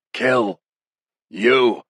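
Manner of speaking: defiant prisoner's strained outburst; furious threat. Acted. Prisoner threatens captor after coughing fit.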